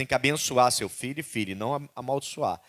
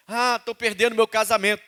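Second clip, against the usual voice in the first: higher, louder whiny excuse; complaining believer; 8 semitones higher, 6 dB louder than usual. Retold or imitated. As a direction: whine about your troubles.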